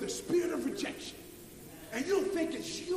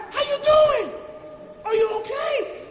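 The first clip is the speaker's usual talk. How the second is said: higher, louder caring questions; concerned coworker; 10 semitones higher, 11 dB louder than usual. Retold or imitated. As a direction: check in on a downcast coworker. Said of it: "She's asking me now".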